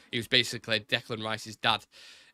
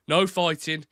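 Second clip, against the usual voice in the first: Thomas Shelby style Brummie accent, raised; 4 semitones higher, 5 dB louder, slower than usual. Shown as a demonstration. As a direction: Brummie take on "No fighting". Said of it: "I could do Brummie as well".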